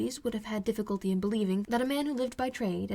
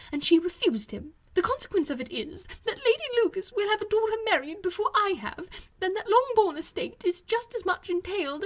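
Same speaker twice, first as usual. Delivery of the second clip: higher, louder, complaining matron voice; a put-upon mother airing her family woes, high and loud; fretful Mrs Bennet; 8 semitones higher, 4 dB louder than usual. A narrator's reading. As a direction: whine your grievances to your sister-in-law.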